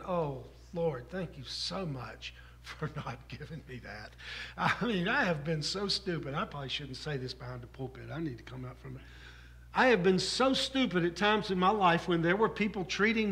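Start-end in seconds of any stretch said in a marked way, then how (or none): 0.0-4.1 softer, low private prayer with a laugh; muttered thanks. Retold or imitated. Preacher recalls thanking the Lord, softer with a chuckle. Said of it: "I'm thinking". 6.3-9.0 quiet aside. Real, not acted. Aside about the pulpit.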